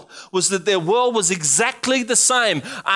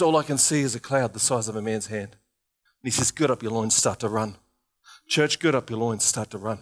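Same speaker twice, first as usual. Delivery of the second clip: quiet preaching; 9 semitones lower, 5 dB quieter than usual. Acted. Soft intimate storytelling.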